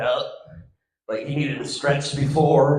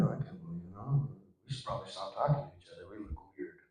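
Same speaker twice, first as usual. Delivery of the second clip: hushed nervous aside; 8 semitones lower, 16 dB quieter, much faster than usual. Retold or imitated. Nervous guys whispering together.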